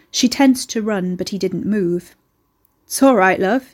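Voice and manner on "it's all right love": louder call across the garden, easy and casual; young man calling out to a nervous woman. A narrator's reading. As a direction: call it over the wall, friendly but cocky. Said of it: "he called across to her".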